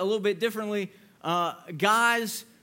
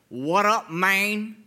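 imitating a friend's dude greeting, a bit louder; mock guy-to-guy "what up"; buddy phone voice; 4 dB louder, much slower than usual. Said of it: "guys do this weird voice change".

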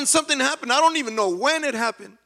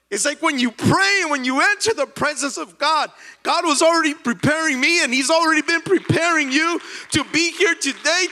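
higher, louder rising sermon cadence; full-force preaching; 6 semitones higher, 4 dB louder than usual. Acted. Pastor lifting the congregation in full preaching fervor. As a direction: build it up like a revival preacher.

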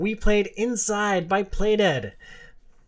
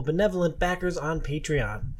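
normal talking voice

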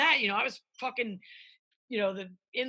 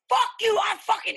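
sudden furious yell, much higher and louder; angry shout; 10 semitones higher, 7 dB louder than usual. Retold or imitated. Cook screaming at a coworker over a slight, furious shout. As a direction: blow up at a kitchen rival. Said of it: "you can be like".

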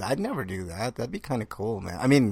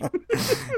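laughing. Real, not acted. Laughing at Apocalypse joke.

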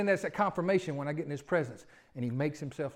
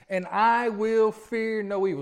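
slow declaration; 3 semitones higher, 3 dB louder, slower than usual. Shown as a demonstration. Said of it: "Say that again with me".

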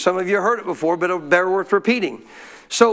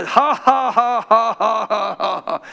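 forced fake laugh; slower than usual. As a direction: laugh without feeling it, clearly put on. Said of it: "I just putting it on".

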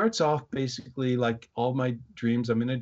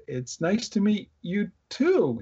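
slow, high, hesitant imitation of a girl; retold greeting, stretched out and pitched up; baffled politeness; 8 semitones higher than usual. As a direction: draw out a polite greeting in disbelief.

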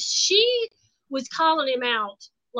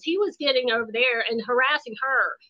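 ordinary delivery

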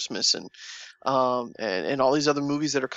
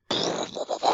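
unvoiced burst imitating booming speakers; imitated speaker rumble. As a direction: blow out a whooshing rumble like cinema sound. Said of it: "then suddenly you hear the".

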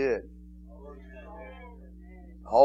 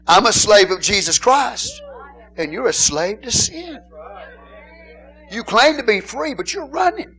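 rising to a shout, louder and higher; preaching at full force; 8 semitones higher, 5 dB louder than usual. Acted. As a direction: thunder it at the congregation.

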